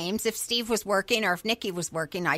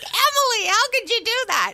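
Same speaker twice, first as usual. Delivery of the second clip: exasperated scolding; 10 semitones higher than usual. Retold or imitated. Scolding daughter after police stop.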